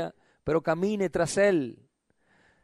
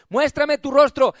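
raised, full-voiced preaching command; insistent demand; 6 semitones higher, 5 dB louder than usual. God telling church to come out, insistent demand. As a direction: demand it of the listener. God's demand, after reading verse.

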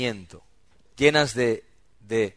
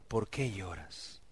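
slow, much softer caring question; tender soft question; 5 semitones lower, 15 dB quieter, slower than usual. Acted. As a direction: ask it gently, like you finally noticed. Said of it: "Qué pocos Elcanas".

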